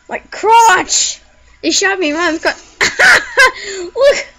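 high, loud laughing complaint; laughing yelp. Real, not acted. Hit in the crotch, laughing.

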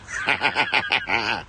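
quick chuckle at own pun; corny dad laugh. Real, not acted. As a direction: laugh at your own joke.

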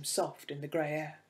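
ordinary delivery

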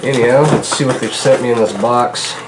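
ordinary talk, nothing marked

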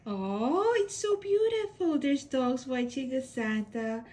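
usual speaking voice

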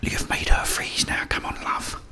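normal talking voice

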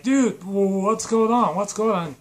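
high, loud mock concern; hammed-up worried shouting; alarmed questioning. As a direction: call out in flustered alarm. Friend alarmed by second scream.

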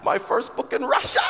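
high, loud, laughing with delight; laughing with excitement. Real, not acted. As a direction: laugh it out, thrilled. Proudly announcing his first book.

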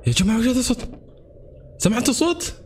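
gasp, then a high alarmed question; startled gasp. Real, not acted. Gamer startled by a noise, gasping high alarmed question to viewers. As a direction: jump at the sudden noise and ask. Hears a sound in horror game.

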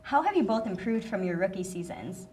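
ordinary delivery

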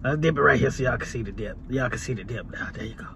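low, fast, strained, burning mouth; mouth burning from spicy food. Real, not acted. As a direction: push through the burn. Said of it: "I'm killing myself with this shit".

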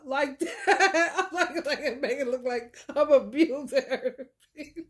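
talking through real laughter; laughing. Real, not acted. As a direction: tell it while cracking up. Cracks up telling cat story.

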